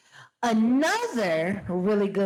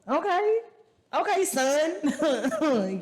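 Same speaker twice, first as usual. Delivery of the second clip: high, drawn-out approving okay, son; slow, high, mock-impressed okay son; 7 semitones higher, much slower than usual. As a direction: stretch it out like you're sizing him up, impressed. Impressed by the boy on screen.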